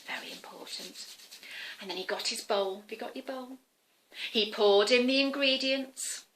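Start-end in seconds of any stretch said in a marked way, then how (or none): 0.0-1.1 breathy unvoiced emphasis; hushed aside to the kids; whispered aside. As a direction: whisper it like a secret. Aside to children while washing.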